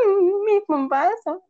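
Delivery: high, drawn-out little whine; hammed-up pitiful moan; mock lament. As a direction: slowly mourn the lost food, playfully. Retold lament over ruined food.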